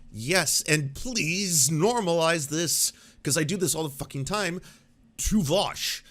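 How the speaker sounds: ordinary talk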